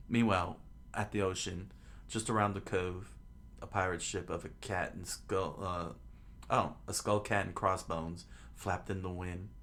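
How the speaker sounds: ordinary delivery, nothing marked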